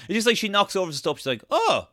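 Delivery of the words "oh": high-pitched retold "oh!"; mock surprised squeal; startled exclamation. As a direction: play up a little startled yelp. Retold film clumsy moment.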